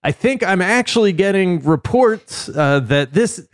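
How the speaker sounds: high-pitched live street report parody; mock field reporter. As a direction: report breaking news live from the scene. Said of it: "[name]'s on the street corner at the location".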